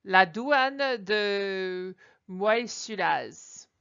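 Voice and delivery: very slow, drawn out; slow French reading. Shown as a demonstration. Teacher reads the Swiss customs sign aloud, slowly. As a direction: read "La douane de Moissulaz" slowly, syllable by syllable.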